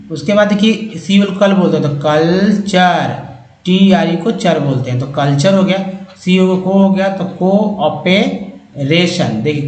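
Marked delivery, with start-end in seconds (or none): 2.0-3.3 slow, stretched-out culture; teacher slowly sounding out a word; syllable by syllable. Shown as a demonstration. Teacher builds word from parts. 7.2-9.4 very slow, stretched cooperation; teacher slowly assembling a word; syllable by syllable. Shown as a demonstration. Teacher builds word from parts.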